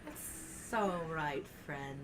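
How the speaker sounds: drawn-out casual brush-off; slow and easy. Acted. Character shrugs off refusal.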